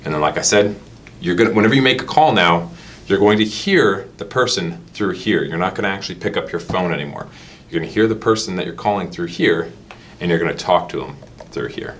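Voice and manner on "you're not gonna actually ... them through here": ordinary talk, nothing marked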